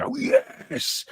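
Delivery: retold scream. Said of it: "and I screamed".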